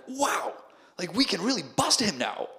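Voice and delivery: high, wowed mock-rookie enthusiasm; excited cops. Two cops thrilled they can bust the scammer, excited. Acts out impressed, eager cops.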